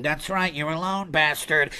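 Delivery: low, quiet elephant taunt; Jumbo. Acted. Elephant has the lone hunter cornered, low quiet menace. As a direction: corner him with a hushed, low threat. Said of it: "I imagine that's how he sounds".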